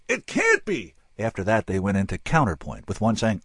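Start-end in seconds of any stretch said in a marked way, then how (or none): 0.1-0.9 much higher cry of disbelief; theatrical disbelief; nailed disbelief. A narrator's reading. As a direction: hit it like a practised stage gasp.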